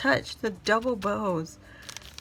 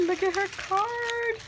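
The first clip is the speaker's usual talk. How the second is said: much higher, delighted exclaim; high excited; 13 semitones higher than usual. Real, not acted. Vlogger showing the viewers Lana's card, high delight. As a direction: point it out in a thrilled rush.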